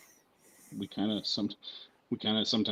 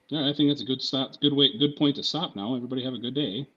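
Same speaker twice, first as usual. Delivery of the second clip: higher, louder mock professor; imitated professor; 5 semitones higher, 6 dB louder than usual. Professor cutting off a crude story in class, imitated raised voice. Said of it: "and the professor was like".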